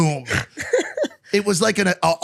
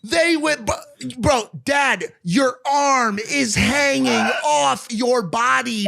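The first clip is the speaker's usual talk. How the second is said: ordinary delivery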